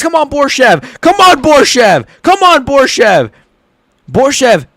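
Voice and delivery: begging cheer, rising in force; desperate cheering. Real, not acted. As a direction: yell him through the choke. Fighter stuck in a choke.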